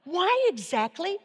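incredulous self-questioning; exasperated question. Shown as a demonstration. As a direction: question your own effort, half-annoyed. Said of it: "you could honestly go".

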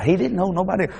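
normal talking voice

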